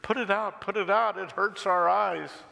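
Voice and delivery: high, urgent plea; fearful outcry. A narrator's reading. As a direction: cave people recoiling from painful light. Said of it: "turned away in fear".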